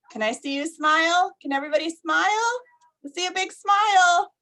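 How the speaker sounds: eager encouraging prompt. Real, not acted. Teacher coaxing children to smile.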